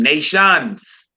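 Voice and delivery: ordinary talk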